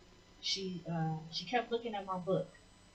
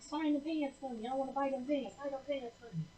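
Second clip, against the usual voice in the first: eager fan; 9 semitones higher than usual. Retold or imitated. Prophetess demanding autograph.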